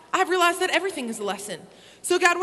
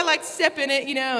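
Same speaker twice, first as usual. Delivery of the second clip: ordinary talk, nothing marked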